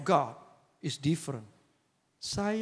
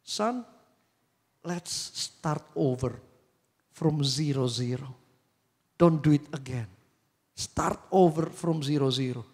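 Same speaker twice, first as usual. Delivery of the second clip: tender to son; 2 semitones lower, slower than usual. Retold or imitated. Father reassuring his failing son, slow and gentle. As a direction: gently offer him a fresh start.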